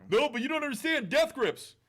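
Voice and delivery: high, loud whiny fan voice; mocking whiny voice. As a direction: whine it like a snobby fan.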